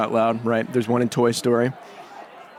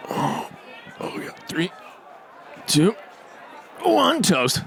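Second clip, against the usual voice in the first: excited toaster; 8 semitones higher, much slower than usual. Acted. Toaster counting down to toast, rising excitement. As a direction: count down and burst out. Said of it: "Here's my toaster".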